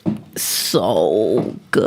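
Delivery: low, slow sultry mock; mock sultry drawl. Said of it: "mocking the message".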